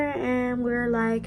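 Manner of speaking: ordinary talk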